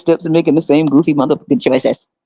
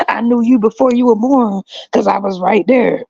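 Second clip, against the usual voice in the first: a hint of a solemn God, higher; mock God voice; 6 semitones higher than usual. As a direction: speak as God lurking nearby. Voicing God in the background.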